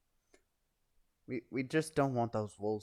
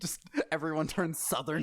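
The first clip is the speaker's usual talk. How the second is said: laughing whisper; 3 semitones higher than usual. Real, not acted.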